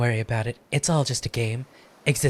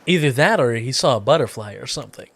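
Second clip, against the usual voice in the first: usual speaking voice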